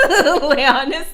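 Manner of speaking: loud, high, laughing call; laughing shout. Real, not acted. Streamer greeting a chat member mid-laugh, loud and laughing. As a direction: burst out laughing as you say the name.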